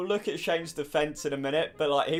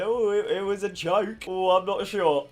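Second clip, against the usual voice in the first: lightly voiced mocking whine; mock his excuse, a touch higher; mocking quote; 4 semitones higher, 4 dB louder than usual. Vlogger mocks Shane's excuse in a lightly put-on voice. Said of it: "he's come out and said".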